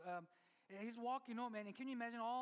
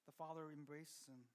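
usual speaking voice

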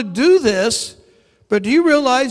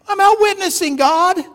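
high-pitched, pleading objection to God; indignant protest; 9 semitones higher, 3 dB louder than usual. Retold or imitated. Protesting God's instruction in retold dialogue.

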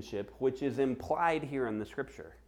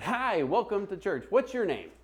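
high, chirpy mock welcome; polite greeting; 9 semitones higher than usual. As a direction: imitate an over-friendly church greeter. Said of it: "Like, just polite".

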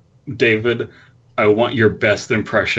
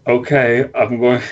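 ordinary talk, nothing marked

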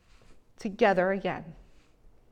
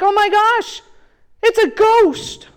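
higher, louder, slow frightened cry; mock terrified; 10 semitones higher, 11 dB louder than usual. Disciples on the boat spotting Jesus, hammed-up terror. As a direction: cry out like you've seen a ghost. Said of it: "They're like".

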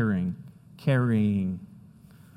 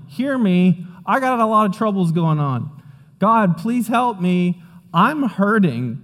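higher, louder pleading cry; desperate plea; 6 semitones higher, 3 dB louder than usual. Retold or imitated. Psalmist begging God in his pain, higher pleading. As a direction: beg God for help. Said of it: "So he's going".

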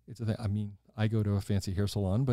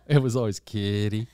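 louder local East Tennessee lilt; East Tennessee accent; 6 dB louder than usual. Shown as a demonstration. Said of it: "her accent was just hellfire".

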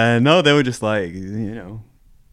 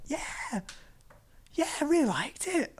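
much higher, soft mock mum voice; imitating mum; 8 dB quieter, slower than usual. Son imitating his mum praising his game, high and soft. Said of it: "she's like".

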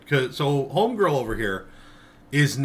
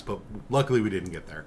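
ordinary talk, nothing marked

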